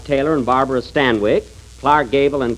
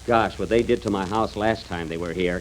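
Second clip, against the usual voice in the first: usual speaking voice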